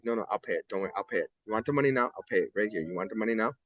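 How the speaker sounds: low-key, as if speaking close to the judge; quiet reassuring father. Retold or imitated. Father quietly offers at judge's desk.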